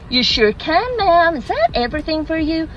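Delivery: high, chirpy American barista; eager-to-please American drive-through voice; American accent. Acted. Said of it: "Sorry, forgive the accent".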